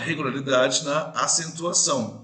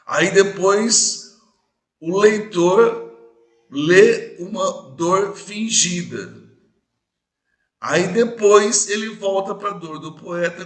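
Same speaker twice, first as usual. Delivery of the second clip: emphatic; 4 semitones higher, 5 dB louder, slower than usual. Shown as a demonstration. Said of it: "explaining the circle".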